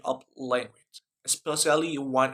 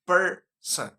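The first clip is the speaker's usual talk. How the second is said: "person" with PER higher and stronger; stress on first syllable; 6 semitones higher, 4 dB louder than usual. Shown as a demonstration. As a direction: punch the PER in "person", let "son" drop away. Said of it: "we must stress per".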